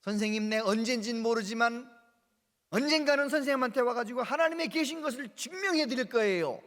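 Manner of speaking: put-on boy vowing to his teacher, defiant. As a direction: proclaim it boldly to the teacher. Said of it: "그랬대요".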